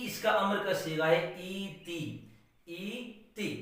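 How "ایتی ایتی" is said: soft drill. Shown as a demonstration. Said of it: "teacher says imperative softly".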